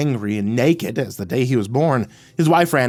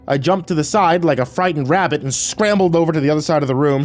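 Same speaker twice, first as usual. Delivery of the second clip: ordinary delivery, nothing marked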